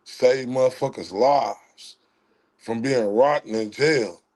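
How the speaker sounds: usual speaking voice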